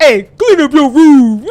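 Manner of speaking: loud, slow, drawn-out mock order; kid imitating stern dad. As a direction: parody a father's command through a child's mimicry. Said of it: "he's like".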